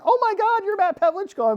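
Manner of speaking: very high, loud starstruck squeal, mimicked; mock tearful fan. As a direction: gush like an overwhelmed fan meeting an idol. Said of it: "started to cry a little bit".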